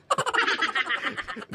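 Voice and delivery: breathy high giggle. Real, not acted. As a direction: laugh at the joke. Laugh after mock threat. (mahinang hagikgik)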